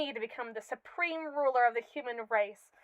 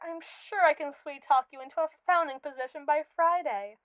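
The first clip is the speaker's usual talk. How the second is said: ordinary talk, nothing marked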